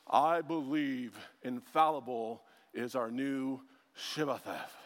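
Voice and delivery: low, slow, portentous imitation; solemn explainer. A guy explaining infallibility, mock-solemn. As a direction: intone it low and slow like a grave pronouncement. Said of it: "what he ends up explaining is".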